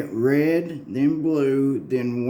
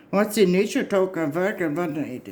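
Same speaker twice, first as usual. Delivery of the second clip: higher-pitched mock gibberish of an odd traveller; higher, half-mocking alien chatter; crazy dimension talk; 7 semitones higher than usual. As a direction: rattle off nonsense like a strange visitor from another dimension. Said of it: "they talk like this".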